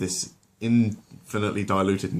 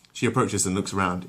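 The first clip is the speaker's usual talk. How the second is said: ordinary delivery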